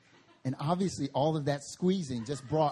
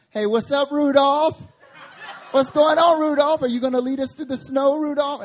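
high, loud, laughing taunt; mocking taunt; 12 semitones higher, 12 dB louder than usual. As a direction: jeer at the kid with the red nose. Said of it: "they said".

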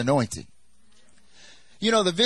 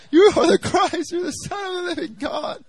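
high-pitched, wailing mock sob; re-enacted crying; 5 dB louder than usual. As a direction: cry it out in a very high voice. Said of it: "I'm, like, crying".

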